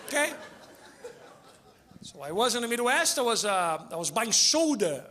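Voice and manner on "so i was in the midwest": ordinary talk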